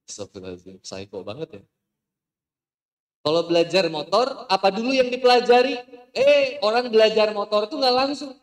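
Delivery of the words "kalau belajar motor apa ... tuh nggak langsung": high, loud rising preacher yell; emphatic shout. Real, not acted. Preacher rousing the audience about learning to ride, loud shout. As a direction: hammer the point home to the crowd.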